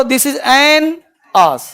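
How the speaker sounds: "This is an ass" with an and ass run together; linked an-ass. Shown as a demonstration. Teacher demonstrating linking to students, run the words together. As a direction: join "an ass" into one smooth "anas" with no break. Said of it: "अब बोलो, इसको बोलो".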